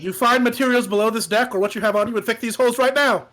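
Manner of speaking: loud commanding order; barking orders. Acted. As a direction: bark orders at crew. Captain ordering crew.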